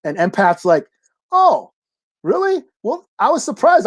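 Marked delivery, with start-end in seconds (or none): 1.3-3.8 high-pitched, taken-aback imitation; mock surprise. Empath to Grey Crow after being thanked, mock surprise. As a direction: act pleasantly thrown by unexpected thanks, pitched way up.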